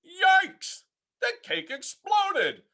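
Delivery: high startled yelp; dad startled by the exploded cake. A narrator's reading. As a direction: jump at the mess. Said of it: "yelped Froggy's father".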